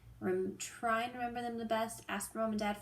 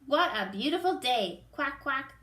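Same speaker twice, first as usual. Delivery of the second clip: louder, cheerful duck shouting; 3 semitones higher, 5 dB louder than usual. Acted. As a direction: call out happily like a noisy duck. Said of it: "shouts Dottie".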